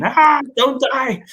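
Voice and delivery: high, drawn-out pleading cry, imitated; slow, desperate mock wail of the stepmom; panicked scream. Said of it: "She was screaming".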